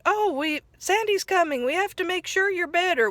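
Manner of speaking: a worried friend speeding up, pitched high; Patrick imitated, hurried. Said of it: "He said".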